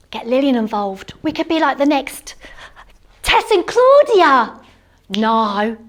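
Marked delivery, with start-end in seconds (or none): 3.2-4.6 high, slow and thrilled; a starstruck squeal, drawn out; excited. Real, not acted. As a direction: stretch the names out in delight. Gushing about presenters.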